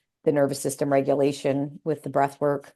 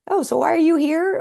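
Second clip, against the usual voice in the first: mimicking the doctor; 11 semitones higher, 4 dB louder than usual. Doctor voiced in her story.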